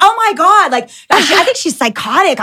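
high, breathless gossip; gossiping gush. Retold or imitated. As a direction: squeal it out as juicy gossip. Girl gossiping excitedly.

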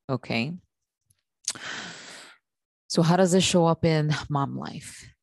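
usual speaking voice

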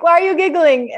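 high, loud, baffled question; retelling a fight, asking her partner why she's giggling, puzzled and loud; incredulous. Retold or imitated. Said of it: "And then I'm like".

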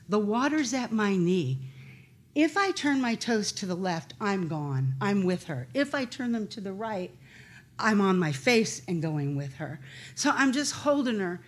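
ordinary delivery

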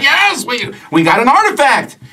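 high-pitched, excited announcement; eager boasting adventurers. Acted. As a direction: proudly show off to rival adventurers. Game master voices eager rival party.

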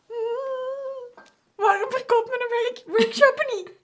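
high whiny mock complaint; squeaky puzzled moaning; whiny complainer. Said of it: "Mensen zijn zo".

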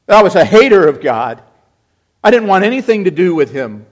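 loud, driving sermon voice, rising; fervent preaching. Acted. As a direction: cry it out to the congregation. Preaches at full force.

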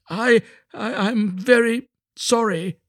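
high, halting apology; slow, high-pitched meek little fellow; apologetic little Hans. A narrator's reading.

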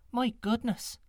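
put-on high, light girlish voice; young girl voice. A narrator's reading.

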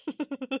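quick high chuckle; giggle. Acted. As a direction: burst into a short giggle. Amused in acted dialogue.

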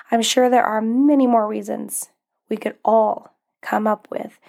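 ordinary talk, nothing marked

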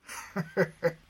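quick amused chuckle; short laugh. Acted. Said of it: "Kinnaman laughed".

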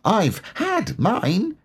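high, slow little voice, proud; proud Roo. A narrator's reading. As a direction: Roo boasting in a tiny high voice. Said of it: "said proudly".

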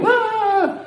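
high imitated child's scream; children's shriek. Children at a parade spooking the horses, imitated shriek. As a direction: squeal like excited kids at a parade. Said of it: "handful of children on the side going".

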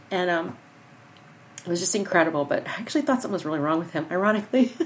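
ordinary delivery

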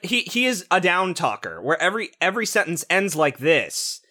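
usual speaking voice

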